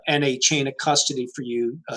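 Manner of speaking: ordinary talk, nothing marked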